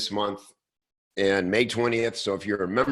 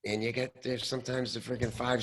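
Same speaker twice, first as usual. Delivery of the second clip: ordinary delivery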